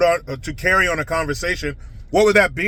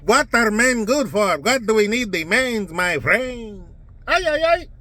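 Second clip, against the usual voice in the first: mock Ana Navarro; 2 semitones higher than usual. Host mocks a pundit on The View, a lightly put-on accent. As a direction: ham up a TV pundit dismissing men. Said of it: "Ana Navarro?".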